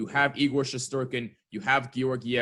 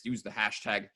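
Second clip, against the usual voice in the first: normal talking voice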